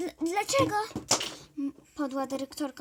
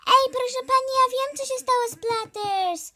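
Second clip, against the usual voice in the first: put-on high, loud eager kid calling out; eager shout; 10 semitones higher, 10 dB louder than usual. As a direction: shout it to the teacher, bursting to tell. Kid eagerly calls out to teacher.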